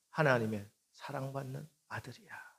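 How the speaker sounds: hushed reassurance to a son; tender whisper. Retold or imitated. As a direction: whisper it lovingly. Father comforting his son.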